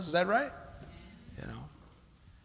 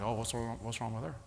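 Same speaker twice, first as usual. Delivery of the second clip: suspicious inner murmur; 5 semitones lower, 11 dB quieter than usual. Retold or imitated. Devil's voice in your head.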